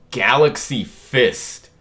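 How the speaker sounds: slow, drawn-out attack name; attack call. Acted. Reader voicing Garp calling his attack, drawn out. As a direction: stretch out each word like a signature move.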